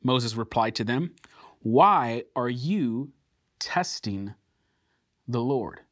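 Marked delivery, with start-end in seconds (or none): 1.6-5.8 drawn-out words with pauses between; slow, weighty rebuke. Acted. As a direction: let each word land on its own. Moses' rebuke read with weight.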